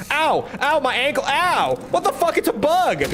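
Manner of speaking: high yelps of sudden pain; startled yelping. Real, not acted. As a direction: yelp as a bug bites. Bitten in the ankle, yelps.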